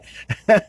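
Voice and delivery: loud burst of laughter at himself; silly laugh. Real, not acted. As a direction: laugh out at the joke. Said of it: "I'm feeling silly today".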